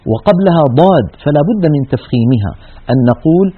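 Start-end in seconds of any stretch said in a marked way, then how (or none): none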